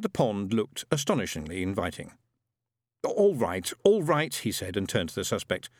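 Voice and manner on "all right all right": put-on louder, rising boyish concession; mildly exasperated child leader. A narrator's reading. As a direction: give in impatiently. Chief Inquisitor gives in, exasperated.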